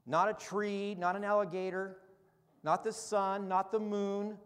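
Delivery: loud, high, fervent preacher voice; impassioned sermon climax; preaching at full force. Acted. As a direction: proclaim it from the pulpit, rising to a cry.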